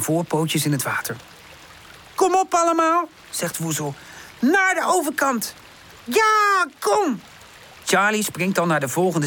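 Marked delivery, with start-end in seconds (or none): 2.1-3.1 high, loud rallying call; pitched way up, raring to go; eager urging. A narrator's reading. Woezel urges the others on. 4.4-7.2 high, excited calls; bright-eyed adventurer, pitched way up; eager urging. A narrator's reading. Woezel urges them across the river.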